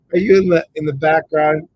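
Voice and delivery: loud, high call to someone off-mic; calling into the background. Real, not acted. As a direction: raise the voice to reach a listener. Calling to a viewer to respond.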